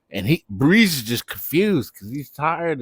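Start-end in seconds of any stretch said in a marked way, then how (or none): none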